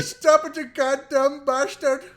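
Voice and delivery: high, loud mock yell; mock shout. The TikTok boy yelling back, a loud high mock shout. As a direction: bellow it in a hammed-up fury. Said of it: "I said".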